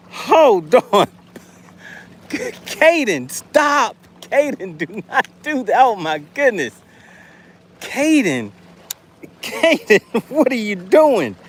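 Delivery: high, laughing pleas to stop; laughing exasperated pleading. Real, not acted. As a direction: beg him to stop, half laughing. Viewer Caden spams super chats.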